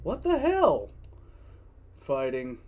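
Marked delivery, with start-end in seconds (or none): 0.0-0.9 high, loud disbelief; shocked outburst. Real, not acted. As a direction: burst out in shock. Streamer reacting to story twist.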